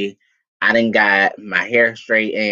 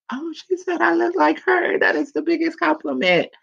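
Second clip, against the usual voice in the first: much higher, giddy relief; thrilled. Retold or imitated. Recalling her joy at mom's words, much higher and giddy. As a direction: squeal it with delight. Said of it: "And I was like".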